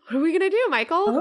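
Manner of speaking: high, gasping surprised question; gasp, then ask in shock. Real, not acted. Reacting to a surprise twist.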